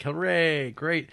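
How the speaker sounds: high, drawn-out pretend hooray; mock cheering. Monks cheer at news of good stew, mock cheering. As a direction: cheer along as the whole crowd, playfully.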